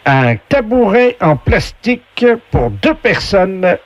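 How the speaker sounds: high, slow showman voice reading the item; game show announcer voice. Pretend. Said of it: "la voix du jeu".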